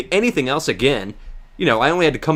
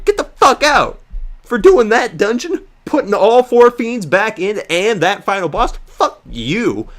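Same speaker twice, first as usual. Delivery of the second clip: mock yell, rising much higher and louder on get the fuck out; furious rant; 6 semitones higher, 4 dB louder than usual. Telling off Square over the last dungeon, pretend furious yelling. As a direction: rage at the developers like a kid. Imagined rant at game company.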